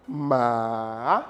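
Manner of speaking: "ma" long and low, then swooping up; dipping third tone. Shown as a demonstration. Teacher showing M with A, "ma" falling then rising. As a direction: sink low on "ma", linger, then climb. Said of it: "Le troisième ton".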